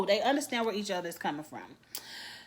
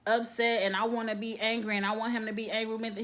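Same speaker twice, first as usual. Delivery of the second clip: ordinary delivery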